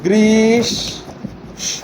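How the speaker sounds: গ্রীষ্ম stretched very slowly into "griish... shõ"; slow pronunciation demo. Shown as a demonstration. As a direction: draw গ্রীষ্ম out in two long syllables, parted at the sh. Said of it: "কি উচ্চারণ করতে হবে".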